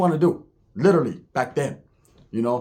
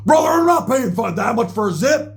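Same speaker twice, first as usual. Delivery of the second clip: imitating a heated, higher-pitched protest; arguing; 7 semitones higher, 3 dB louder than usual. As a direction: argue it indignantly, pitched up. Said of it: "hear Roach arguing with them".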